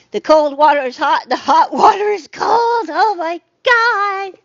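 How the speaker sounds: hammed-up rising panic, loud and high; mock horror. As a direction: melodramatic horror-story alarm.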